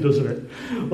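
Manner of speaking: usual speaking voice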